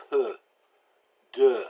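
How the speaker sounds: p, d as French 'peu, deux' with rounded lips; lips pushed out. Shown as a demonstration. Teacher showing the E U sound, pursed lips. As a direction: stick your lips out on peu and deux. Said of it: "stick your lips out".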